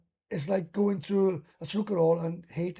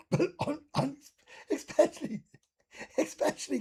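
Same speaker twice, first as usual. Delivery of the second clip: laughing; 9 semitones higher than usual. Real, not acted. Laughs mid-sentence at club name.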